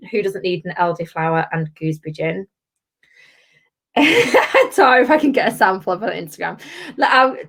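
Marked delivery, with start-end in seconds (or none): 3.9-4.7 brief high giggle; a quick burst of high laughter; short laugh. Real, not acted. Amused by viewers' comments.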